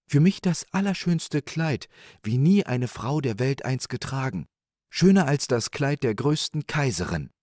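high, eager woman's voice; high-pitched rapture as the wife; wishing wife. A narrator's reading. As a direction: wish grandly for the finest thing.

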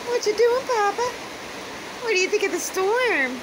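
high, loud sing-song pet voice; pet talk. Real, not acted. Talking to her rooster Papa in a high pet voice. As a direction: coo up at the pet brightly.

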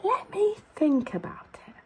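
thoughtful whisper to himself; hushed storybook musing. Acted. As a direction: whisper it like thinking aloud. Pondering aloud in story.

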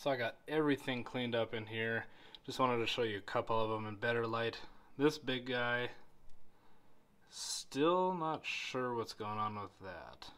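low-key indoor talk; quiet. Real, not acted. Indoors, calm closing.

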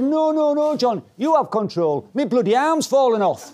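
high-pitched mock panic. Pupil to instructor in a spiral dive, retold urgent panic. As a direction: plead urgently, acted alarm rising up high. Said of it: "said with a little more urgency".

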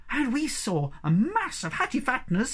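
high-pitched, eager Sniff-like voice; excited small creature. A narrator's reading. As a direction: pipe up with breathless news. Sniff excitedly adds his news.

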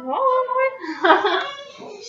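a burst of high, surprised giggling at herself; high giggle. Real, not acted. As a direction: laugh it off, a little embarrassed. Laughs at nose near scent.

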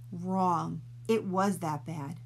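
ordinary delivery

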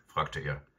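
ordinary delivery, nothing marked

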